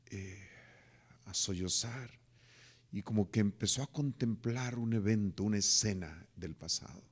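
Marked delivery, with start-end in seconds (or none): none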